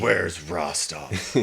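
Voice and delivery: low, quiet, slow tough guy; soft-spoken badass. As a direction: drag it out low and calm. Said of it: "his tone of voice never changes".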